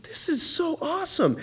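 hushed, high, amazed; a hint of wide-eyed awe; awed whisper. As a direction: breathe it out in wonder. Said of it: "I was like".

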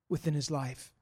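ordinary talk, nothing marked

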